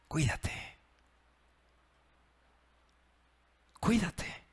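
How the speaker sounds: soft, hushed "Cuídate", said with love; tender caring. Shown as a demonstration. Pastor shows a loving goodbye, softly caring. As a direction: tell them gently you care. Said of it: "Puedo ir con alguien y decirle".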